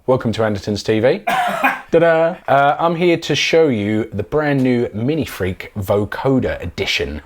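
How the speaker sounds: ordinary talk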